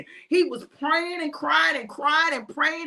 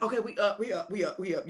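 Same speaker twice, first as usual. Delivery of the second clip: groggy disciples; 6 semitones lower, 7 dB quieter, faster than usual. Acted. Groggy disciples insisting awake.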